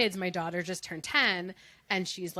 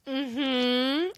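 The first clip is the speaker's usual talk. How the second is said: high, drawn-out dismissive hum; mimicked sassy mm-hmm; 5 semitones higher, 4 dB louder than usual. Mother imitating daughter asked to pick up clothes, sassy. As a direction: imitate a child half-agreeing with attitude. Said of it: "now she does that to me".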